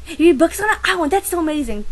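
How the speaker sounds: mock amazed, high and loud; amazed classmate. Classmate echoing her answer, mock amazed. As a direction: gush like a clueless kid. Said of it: "So I tell them".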